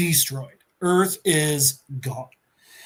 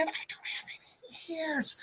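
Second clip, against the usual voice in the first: whispered, no voice; whispered punchline; 9 semitones higher, 13 dB quieter, faster than usual. Retold or imitated. Ghost Rider joking to Thanos in a whisper. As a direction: hush it like a secret joke. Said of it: "Cosmic Ghost Rider goes".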